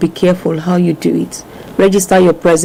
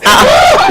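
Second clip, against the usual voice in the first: very high-pitched "ah, ah"; sharp high exclamation with a laugh; mock outraged cry; 3 dB louder than usual. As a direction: squeal in playful protest. Playful outcry at guest's advice.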